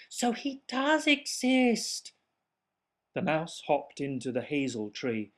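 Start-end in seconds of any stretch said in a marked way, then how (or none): 0.1-2.1 amazed. Acted. Awestruck realization.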